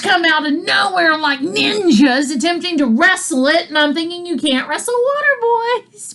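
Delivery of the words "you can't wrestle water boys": high singsong heckle; retold thought, pitched way up; mock calling out. Quotes own thought to groundskeepers.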